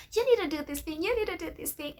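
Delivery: high, otherworldly nagging voice; inner nudging voice. Retold or imitated. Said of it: "that nudge ... it pesters you".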